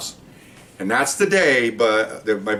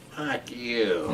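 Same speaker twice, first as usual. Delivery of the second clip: quiet, drawn-out imitation of a bird; talking bird; 11 dB quieter than usual. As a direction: mimic a bird softly talking back. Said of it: "what he taught the bird".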